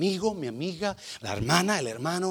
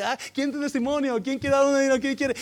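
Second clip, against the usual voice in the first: high, raised acted announcer call; calling for testimonies; 9 semitones higher, 6 dB louder than usual. Church leader asks who will testify, high calling voice. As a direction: call out to the congregation, pitched high.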